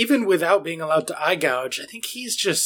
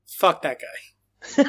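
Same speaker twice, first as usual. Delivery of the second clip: slow, deadpan dismissal; much slower than usual. Acted. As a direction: drag it out, mock serious. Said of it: "here's my in-depth analysis".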